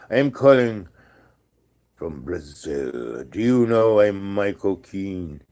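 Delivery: put-on slow, drawn-out foreign caller in a European accent; odd French-ish accent, words stretched; ominous trailer-style European accent; strange European accent. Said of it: "this voice with a kind of accent".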